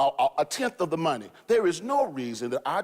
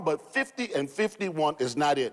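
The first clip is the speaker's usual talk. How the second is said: normal talking voice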